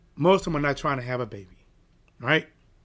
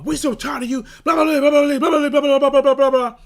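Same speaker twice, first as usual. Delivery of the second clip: loud, higher mocking rant, rattling off blahs; mock yell; 10 semitones higher, 10 dB louder than usual. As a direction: ham up their shouting, spitting out the blahs. Retelling the mother's yelling.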